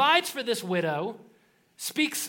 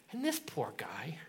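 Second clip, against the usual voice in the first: softer, hushed whisper; 7 semitones lower, 11 dB quieter than usual. Acted. Storyteller hushing the crowd before the guy speaks, whispering. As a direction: lean in and whisper it.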